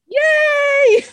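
high, loud "yay"; loud celebratory whoop; excited cheer. Real, not acted. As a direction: cheer the right answer in a high squeal. Cheering a correct trivia answer.

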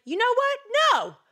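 squeaky high loud no; high defiant snap. Acted. David answering his doubting brothers, high defiant snap. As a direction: slam the door on them.

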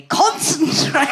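loud, high, drawn-out mock order; retold shout. Friend's boyfriend shouting at her, mock shout. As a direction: bark it out like an exasperated boyfriend. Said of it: "Matt's always shouting at me".

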